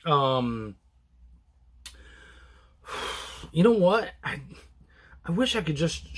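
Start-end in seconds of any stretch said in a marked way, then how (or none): 2.9-3.5 soft breathy exhale; sigh. Real, not acted. Host pausing before describing the book, sighing. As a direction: let out a quiet sigh. Pausing while searching for words.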